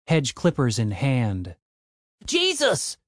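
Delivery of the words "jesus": shouted. A narrator's reading. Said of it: "shouted Tim".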